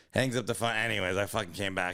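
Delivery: ordinary delivery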